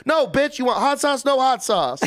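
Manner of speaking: high, loud mock yell of the counter lady; retold yelling. A guy retelling the deli lady snapping at a customer, loud mock yell. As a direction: bark it like a rude deli worker. Sandwich worker barking at customer.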